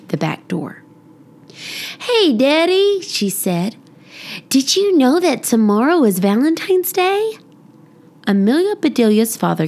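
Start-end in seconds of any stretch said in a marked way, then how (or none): 2.0-3.0 high, bright hello to dad; excited greeting. Acted. Girl greeting her father. 4.5-7.4 put-on eager child. Girl tells dad excitedly.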